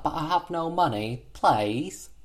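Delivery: put-on little girl. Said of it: "the girl's like".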